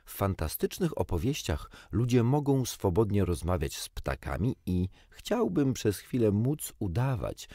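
ordinary delivery

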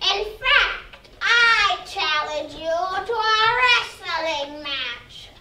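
very slow, drawn-out spooky sprite; water sprite voice. Acted. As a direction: stretch every word like a lake spirit.

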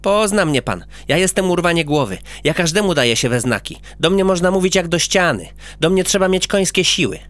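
lightly voiced cheeky little boy; a child boasting to the doctor; a hint of a chatty little boy; boy character.

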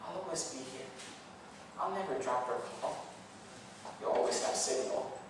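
calm soothing God. Acted. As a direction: speak softly like a loving father. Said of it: "so soothing and calming".

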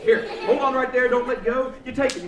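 normal talking voice

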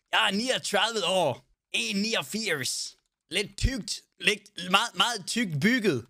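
mock American accent. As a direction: play a Texan cowboy with an American accent. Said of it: "Skal vi snakke med amerikansk accent?".

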